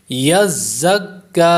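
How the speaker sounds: یزکا recited slowly with a firm za joined to kaf; tajweed recitation. Shown as a demonstration. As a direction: press za firmly into kaf in یزکا. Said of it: "کاف باریک ہوگا".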